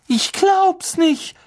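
hoch, laut und langsam geschrien; ungläubig aufschreien; Schrei. A narrator's reading. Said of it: "schrie Hermine". (fassungslos geschrien)

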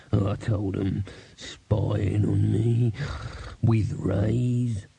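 slow, drawn-out paranoid beggar; Foul Old Ron. A narrator's reading. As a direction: rave word by word, dragging it out. Foul Old Ron's paranoid raving.

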